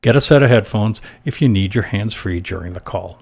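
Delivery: normal talking voice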